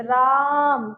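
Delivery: drawn-out call राम with a long आ; calling out Raam. Shown as a demonstration. Teacher shows calling a child राम with a long vowel. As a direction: stretch राम into a calling shout, राम held long. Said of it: "कैसे बोलेंगे?".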